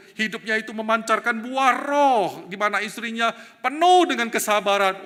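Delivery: preaching at full force; fervent preaching. Acted. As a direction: build the fire of the sermon. Preaching builds.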